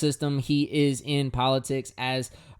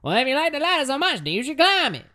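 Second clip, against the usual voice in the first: put-on much higher, fast old-time announcer voice; fifties sports announcer; 12 semitones higher, faster than usual. Artist replaying his own lyric as an old-time announcer. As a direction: rattle it off like a fifties radio sportscaster. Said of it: "I'm like a fifties sports announcer or something".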